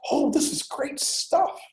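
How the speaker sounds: the professor gushing over a paper, high and slowed down; delighted professor. Retold or imitated. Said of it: "the professor would love it".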